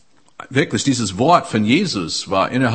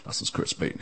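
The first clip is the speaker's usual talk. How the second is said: ordinary talk, nothing marked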